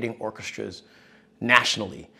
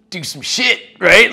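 excited; 9 semitones higher, 12 dB louder than usual. Retold or imitated. Excited retold prank proposal.

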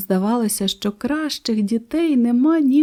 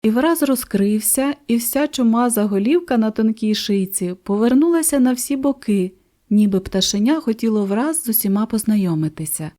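ordinary delivery

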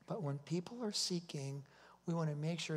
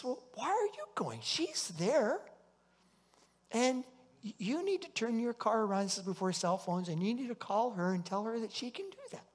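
a higher, raised voice questioning firmly; voice of the Lord; 6 semitones higher than usual. Retold or imitated. The Lord telling Jerry to turn around, raised higher voice. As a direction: correct him gently but with authority. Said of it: "He says".

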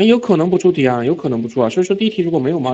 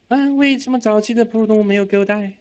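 ordinary talk, nothing marked

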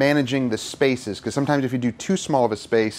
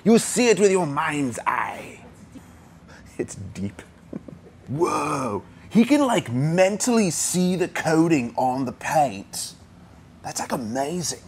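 hammed-up California-Australian twang, pitched higher; weird California-Australian accent; 4 semitones higher than usual. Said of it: "like, a weird California, Australian accent".

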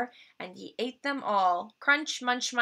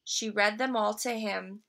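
ordinary delivery, nothing marked

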